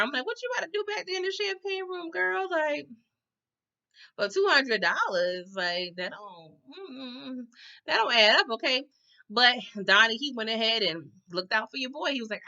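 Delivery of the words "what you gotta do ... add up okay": sassy high. Retold or imitated. Vlogger sassy commentary.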